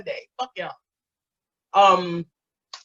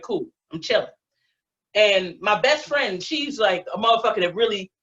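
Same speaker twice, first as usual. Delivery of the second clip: ordinary talk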